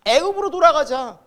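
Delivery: acted cry of the people; rallying cry. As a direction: call it out like a rebellious crowd.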